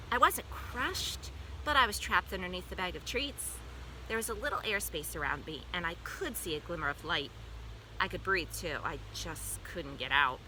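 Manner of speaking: usual speaking voice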